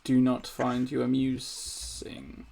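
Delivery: slow, drawn-out menace; slow, creepy game villain voice; sinister sisters. Acted. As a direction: stretch each word out in cold displeasure. Game master voices the unamused sisters.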